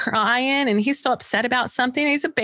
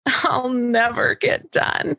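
put-on whiny child; 4 semitones higher, much slower than usual. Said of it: "actual things that she said to me".

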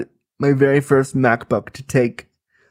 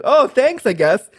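awkward high thanks; 9 semitones higher than usual. Retold or imitated. Awkward reply to roommate.

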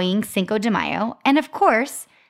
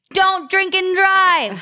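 high, slow announced warning; mock warning voice; 10 semitones higher, 4 dB louder, slower than usual. Bag warning someone with boozy breath, high mock warning.

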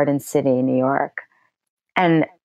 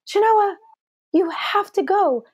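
much higher, urgent friend on the phone; imitating a thrilled friend; excited friend; 13 semitones higher than usual. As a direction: push her to go, all excited. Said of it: "And she says".

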